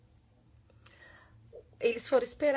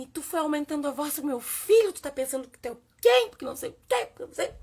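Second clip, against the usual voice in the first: louder, higher heated reproach; angry confrontation; 5 semitones higher, 9 dB louder, faster than usual. Retold or imitated. Mother defending her son from the stranger, heated and loud. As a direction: go at him for raising his voice. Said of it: "E eu disse assim".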